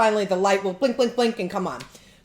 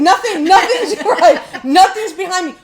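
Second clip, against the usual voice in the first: laughing; 10 semitones higher, 10 dB louder than usual. Real, not acted. Laughing, loud retelling.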